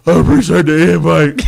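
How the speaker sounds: loud, put-on deep-chested player voice; Calais Campbell impression. Host imitates Calais Campbell declining the invite, loud put-on voice. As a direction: boom it like a big defensive lineman. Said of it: "my Calais Campbell voice".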